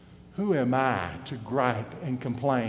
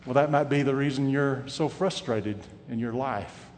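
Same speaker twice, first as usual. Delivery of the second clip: ordinary talk, nothing marked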